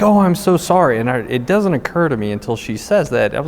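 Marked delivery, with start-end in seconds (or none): 0.0-1.0 high, louder imitation of dismayed sympathy; mock shocked apology. Desk woman apologizing after misunderstanding about the wife, imitated dismay. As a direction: imitate her gushing, mortified apology.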